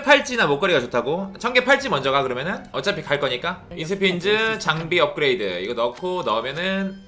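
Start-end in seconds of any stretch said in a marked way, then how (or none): none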